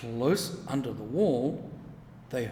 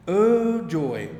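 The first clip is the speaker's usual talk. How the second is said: bright burst of delight, higher and louder; joyful exclamation; 5 semitones higher, 8 dB louder than usual. A narrator's reading. Narrator bursting with joy over the lettuces. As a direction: cry out with glee at the lettuces.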